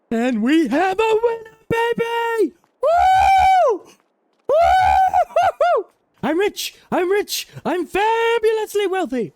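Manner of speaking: high whooping victory shout; shrieking jackpot winner. Real, not acted. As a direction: whoop like you just got rich. Claiming the jackpot.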